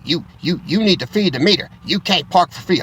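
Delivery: mock the meter maid telling him off; imitating angry officer. Parking officer scolds him to feed the meter, mock scolding.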